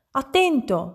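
high warning cry; shriek. A narrator's reading. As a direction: cry out in sudden alarm. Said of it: "strillò la moglie". (strillo di avvertimento)

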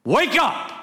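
high, shouted fatherly wake-up call; father's wake-up voice. Retold or imitated. Father rousing his teenage son from bed, shouted wake-up call. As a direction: yell like a dad rousing a sleeping teenager. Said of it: "I'm gonna use my father's voice".

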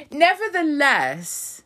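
high exaggerated. Shown as a demonstration. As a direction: stretch it up high and slow. Repeated word, different takes.

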